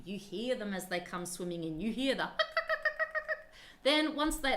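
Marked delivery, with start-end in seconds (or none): 2.3-3.8 penguin call. Shown as a demonstration. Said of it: "that's the noise they use when they come in".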